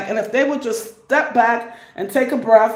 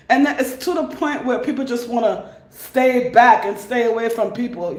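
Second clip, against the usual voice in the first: ordinary talk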